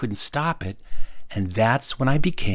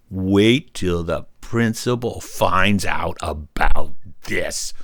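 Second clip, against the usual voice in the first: very slow, low and ominous; deep, drawn-out mock menace; scandalized threat; 5 semitones lower, much slower than usual. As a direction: spell out the threat word by word. Child's indignant scandalized thought.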